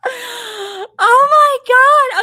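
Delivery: high, drawn-out squeal breaking into laughter; real overwhelmed happy shriek; screaming with excitement. Real, not acted. Grandmother reacting to the boy reveal, screaming in delight. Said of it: "I'm screaming in the microphone".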